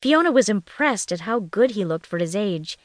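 ordinary delivery, nothing marked

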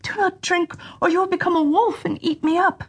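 put-on high pleading girl's voice; sister crying out. A narrator's reading. Sister pleads again with her brother, high and pleading. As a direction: beg him, pitched high. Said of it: "the sister cried out".

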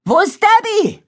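high, loud worried cry; urgent shout. A narrator's reading. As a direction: shout the question across the rain. Katie yells for missing friend.

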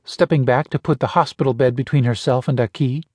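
ordinary delivery

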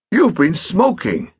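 fussy stepfather scolding; testy old gentleman. Acted. Pett accuses.

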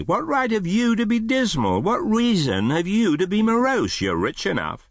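very high-pitched, merry challenge. Acted. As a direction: throw it back playfully, voice lifted high. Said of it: "returned the nephew gaily".

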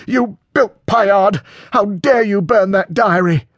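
high, loud outraged cry; furious outburst. A narrator's reading. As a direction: explode at him over the betrayal. Acted quarrel as blows begin.

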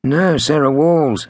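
muttered echo. A narrator's reading. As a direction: echo it slowly to yourself. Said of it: "Stefan muttered".

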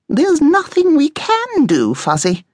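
very high, slow character voice; high-pitched, drawling naysayer; bossy guinea pig, dismissive. A narrator's reading. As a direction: shut the idea down in a high, drawn-out voice.

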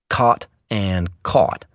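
"caught" and "caught" said slowly as sound-alikes, cot and caught merged; Southern word merger. Shown as a demonstration. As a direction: draw out each "caught", giving both the same vowel. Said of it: "merging of certain words".

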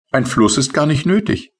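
high, light little sprite voice; tiny rain sprite. Acted. Tipsel's voice in story. (hohe, leichte Koboldstimme)

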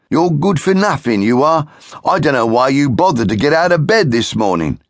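high, loud taunting brother; bullying brother. Acted. Brother mocks Shiloh for being useless, high loud sneer. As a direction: sneer down at him.

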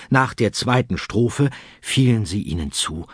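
usual speaking voice

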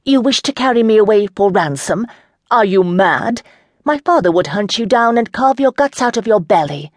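a lady scorning her captor, heated; defiant young lady. Acted. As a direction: throw the threat back at him. Chandra defies the knight.

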